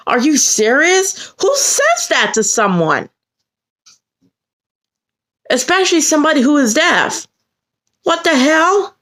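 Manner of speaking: high-pitched, appalled outrage; pissed off. Retold or imitated. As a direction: protest in incredulous indignation. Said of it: "I was pissed".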